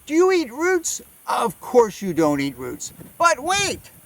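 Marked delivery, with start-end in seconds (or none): none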